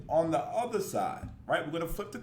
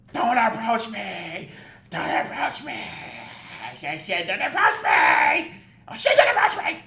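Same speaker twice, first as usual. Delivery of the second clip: hammed-up screaming fit, building louder; mock yelling; 7 dB louder, slower than usual. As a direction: imitate her yelling, escalating into a shriek. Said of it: "For you to yell at somebody".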